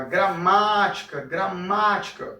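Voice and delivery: "gramática" drawn out long on MA; gra-MAAA-tica, slow on the stressed syllable; stretched stressed syllable. Shown as a demonstration. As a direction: hold the MA in gramática, as if calling. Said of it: "o ma é o que eu estico mais".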